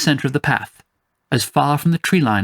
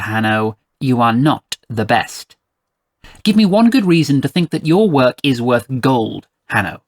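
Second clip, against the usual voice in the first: commanding, haughty mother voicing her terms; stern, imperious employer; 3 semitones higher, 3 dB louder than usual. Mother setting payment for rescue mission, stern and imperious. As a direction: lay down terms with pointed emphasis.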